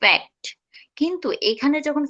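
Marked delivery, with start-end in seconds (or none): 0.0-0.4 ফ্যাক্ট with the c said as a k sound. Shown as a demonstration. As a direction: say ফ্যাক্ট, k not s in the middle. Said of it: "like that".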